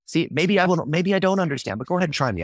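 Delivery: high-pitched feigned ignorance; retold challenge, pitched well up; mock-humble challenge.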